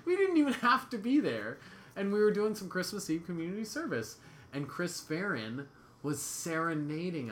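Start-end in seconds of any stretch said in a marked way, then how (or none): 0.1-1.6 high, laughing through the words. Real, not acted. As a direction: laugh while agreeing. Laughing at joke.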